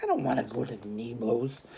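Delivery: low, soft, sulky mumble; mumbled. A narrator's reading. Boy refusing the theatre trip, sulky low mumble. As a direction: mutter it under the breath. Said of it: "Charlie mumbled".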